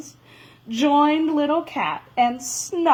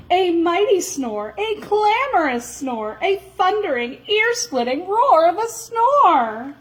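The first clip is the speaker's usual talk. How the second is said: rising higher and louder toward the roar; building snore drama; 8 semitones higher, 3 dB louder than usual. A narrator's reading. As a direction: pile each snore bigger than the last. Snore described ever bigger.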